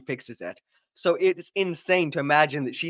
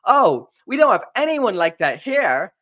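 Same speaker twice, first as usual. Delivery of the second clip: mock alien official; 8 semitones higher, 7 dB louder than usual. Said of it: "the Trade Federation dude is like".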